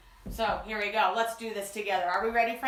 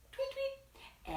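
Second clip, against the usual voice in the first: bird tweet; 12 semitones higher, 11 dB quieter than usual. Acted. Wren call.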